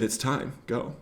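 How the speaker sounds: barely above a whisper, calm. Retold or imitated. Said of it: "he whispered to me".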